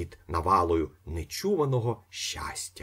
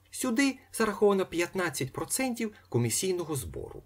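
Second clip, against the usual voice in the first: much higher lady's voice; young lady; 12 semitones higher than usual. A narrator's reading. Young lady explaining the fee, high voice. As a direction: explain it primly as a clerk.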